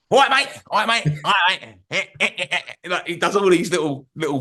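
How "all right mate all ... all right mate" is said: overdone Cockney geezer, cheery; Danny Dyer Cockney. Retold or imitated. Mocking a young fan to his mate, in a put-on Cockney accent. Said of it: "Danny Dyer Cockney".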